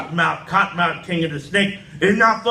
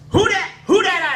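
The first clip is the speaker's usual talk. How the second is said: high, loud squeaky challenge; granny calling out; 13 semitones higher, 4 dB louder than usual. Retold or imitated. Granny calling to the knocker, high and loud. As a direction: shout it through the door like a startled granny. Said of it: "I say".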